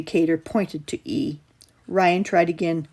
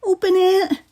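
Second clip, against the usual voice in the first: high, loud desperate cry; a terrified kid begging; frantic shout; 13 semitones higher, 5 dB louder than usual. A narrator's reading. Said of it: "Kyle cried".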